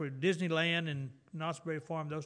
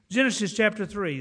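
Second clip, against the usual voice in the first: Genesis chapter three called out louder, Genesis stressed; emphatic repeat; 4 semitones higher, 7 dB louder than usual. Real, not acted. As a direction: repeat it firmly like a preacher calling the text. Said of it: "Go back with me to Genesis".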